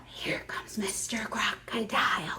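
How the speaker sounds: low breathless whisper, creeping along; sneaky whisper. A narrator's reading. Narrator whispers the sneaking crocodile's approach to children. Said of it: "quiet as can be".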